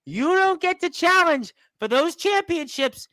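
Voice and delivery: loud, high-pitched wrestling announcer's outburst; worked-up shouting. Real, not acted. Host reacting to the champion's last word, worked-up shouting.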